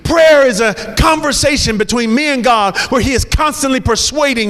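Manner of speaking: rising, ringing pulpit preaching; fervent sermon delivery building over music; preaching at full force. Acted.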